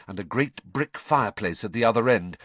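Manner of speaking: plain reading voice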